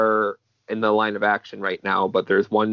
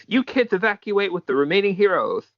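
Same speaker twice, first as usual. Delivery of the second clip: acted yell; 10 semitones higher than usual. Hero ordering kids to evacuate, high acted yell. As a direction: read it as a mock yell, pitched up. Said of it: "And then she yells".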